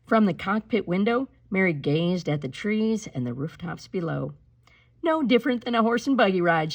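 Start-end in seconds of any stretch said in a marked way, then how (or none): 5.0-6.7 quick, higher, louder playful quip; tossed off lightly as a joke; joking. A narrator's reading. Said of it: "she joked".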